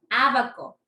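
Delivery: Á-baco with a strong opening syllable; stress on first A. Shown as a demonstration. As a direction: punch the first A of abaco. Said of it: "intensidad de la pronunciación".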